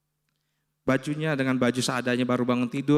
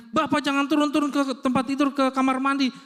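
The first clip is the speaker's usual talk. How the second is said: high-pitched mock telling-off, held up all through; retold scolding; 8 semitones higher than usual. Staff to patient leaving his bed, retold scolding. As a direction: imitate hospital staff ordering a patient about. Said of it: "saya dimarah-marahin".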